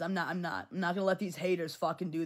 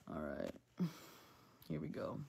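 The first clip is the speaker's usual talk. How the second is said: whispered aside; 14 semitones lower, 13 dB quieter, slower than usual. Real, not acted. Muttered before reading.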